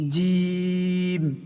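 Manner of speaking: জিম stretched very slowly with a hard sound; long chanted জিম; drawn-out jim. Shown as a demonstration. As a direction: hold জিম for four alif lengths. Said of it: "চার আলিফ টান দিয়ে পড়তে হবে".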